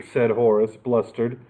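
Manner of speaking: ordinary delivery, nothing marked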